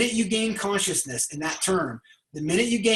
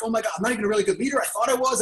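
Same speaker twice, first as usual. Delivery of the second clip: panicked rush; 3 semitones higher, much faster than usual. Retold or imitated. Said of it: "They're like".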